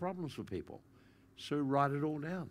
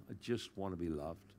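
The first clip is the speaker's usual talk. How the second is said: put-on much softer and lower, a small child's plea; childlike plea; 6 semitones lower, 7 dB quieter than usual. The grieving heart speaking like a child, soft and small. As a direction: say it like a hurt little child. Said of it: "the talk and words and language of a child".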